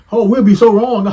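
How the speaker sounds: high, loud sarcastic whine; mock the other side's fake outrage.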